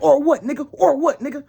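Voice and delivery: high, loud yelled challenge; furious retold shout. Confronting the girl's friend at his house, a furious yell. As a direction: bark it back like you're ready to fight. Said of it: "Now, I'm crashing out".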